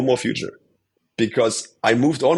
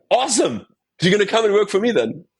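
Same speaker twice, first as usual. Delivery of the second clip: imitating boss, delighted and higher; excited boss; 9 semitones higher than usual. Boss cheerfully offering him a job, mock delighted. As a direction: pitch it up like a gleeful friend. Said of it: "And he goes like".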